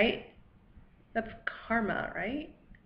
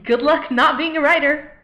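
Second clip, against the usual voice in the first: higher, building to a loud brush-off; mocking rejection; 5 semitones higher, 6 dB louder than usual. Editors rejecting her writing, loud mockery. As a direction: voice a cruel rejection email, louder as it goes. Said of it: "or the emails that say".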